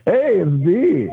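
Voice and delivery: high, drawn-out happy hello; happy greeting. Real, not acted. Caller greeting the show hosts, happy. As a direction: stretch it out high and cheerful. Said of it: "You sound so th-, happy today".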